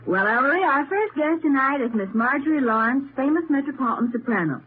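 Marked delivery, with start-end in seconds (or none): none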